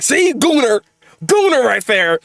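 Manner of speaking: high-pitched mock accusation; jeering community. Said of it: "the community looks at it and be like".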